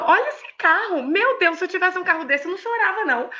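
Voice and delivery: high, exclamatory mock disbelief; chiding a crying pastor over her car; incredulous teasing. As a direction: marvel at the car to shame her tears. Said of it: "E eu falando".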